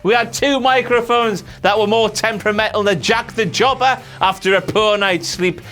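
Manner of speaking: ordinary delivery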